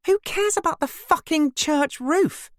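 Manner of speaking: very high, loud exasperated cry; angry snapping. A narrator's reading. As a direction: explode in fury, pitch shooting up. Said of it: "I snapped".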